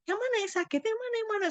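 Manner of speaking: high, urgent questions, not shouted; retold anxious questioning. Daughter checking fallen mother for injury, retold anxious high questions. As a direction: pitch leaps up as if checking for injury.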